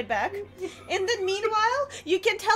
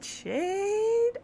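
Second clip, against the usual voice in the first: ordinary delivery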